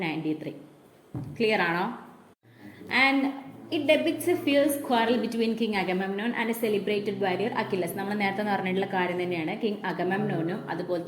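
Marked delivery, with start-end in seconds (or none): 3.0-7.9 reading the English text very slowly for notes; measured classroom dictation. Shown as a demonstration. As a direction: read it out slowly so the class can write it down.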